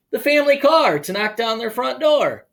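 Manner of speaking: animated storytime narration; story reading. Acted. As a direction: read it like a children's picture book. Reads picture book aloud.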